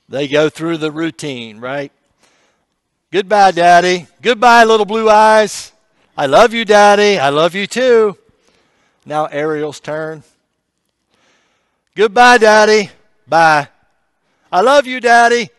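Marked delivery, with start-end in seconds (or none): 3.1-8.2 loud, higher voiced farewell; family calling goodbye across a sickroom, loud. Acted bedside goodbye routine. 11.9-15.5 loud, higher voiced farewell; family calling goodbye across a sickroom, loud. Ariel's turn at goodbye.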